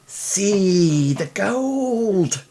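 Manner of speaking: dreamy awe. Acted. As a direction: savour every word.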